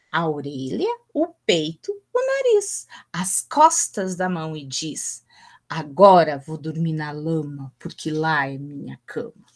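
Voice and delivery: put-on expressive children's poem recital, voices shifting with the lines; poem reading. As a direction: read it like a storyteller for small children. Reads a children's poem aloud. (leitura expressiva de poema infantil)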